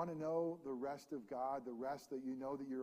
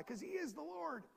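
higher and louder, driving sermon voice; full-force preaching; 5 semitones higher, 7 dB louder than usual. Acted. Pastor exhorting the church, preaching at full force. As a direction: preach it out to the congregation with rising fervor.

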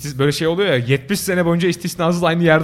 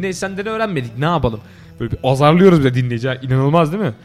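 usual speaking voice

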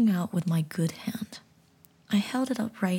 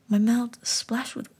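usual speaking voice

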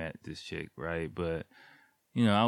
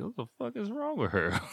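much higher, incredulous; baffled exclaim; 14 semitones higher than usual. Retold or imitated. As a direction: squeak out your disbelief. His confused reaction.

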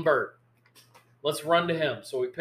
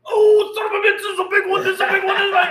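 much higher, louder, thrilled yelp; excited dad; 10 dB louder, faster than usual. Retold or imitated. Dad hooks a fish, yelling it's a big one excitedly. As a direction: ham up a thrilled old fisherman hooking a big one. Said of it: "He was so excited".

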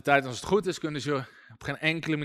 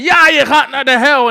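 high, very loud acted retort; hammed-up furious shout; shouting at a demon; 9 semitones higher, 14 dB louder than usual. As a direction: imitate a lad yelling back at a demon. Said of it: "Dus die ene jongen zei".

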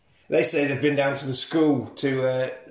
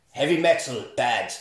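usual speaking voice

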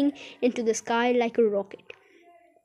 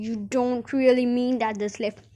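ordinary delivery, nothing marked

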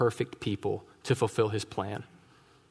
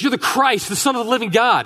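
high, loud, bursting proclamation; excited declaration; 9 semitones higher, 6 dB louder than usual. Retold or imitated. Peter proudly answering Jesus in front of everyone, excited. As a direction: blurt it out like a breakthrough.

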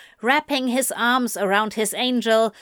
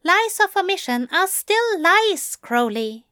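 higher-pitched prim retort; 10 semitones higher than usual. A narrator's reading. Angel correcting Crowley on lies of omission, retorting. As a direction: answer back in a higher character voice. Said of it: "Aziraphale retorted".

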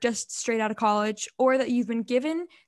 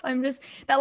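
ordinary talk

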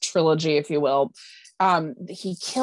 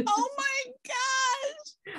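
amazed; 6 dB quieter, much slower than usual. Real, not acted. Reacts to water skis fire.

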